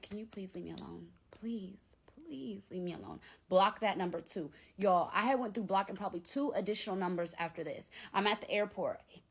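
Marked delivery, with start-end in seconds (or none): 0.0-3.2 much softer, half-whispered begging; quiet plea. Retold or imitated. Retelling her plea to the man who keeps calling, hushed begging. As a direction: drop right down and plead. Acted weary plea to caller.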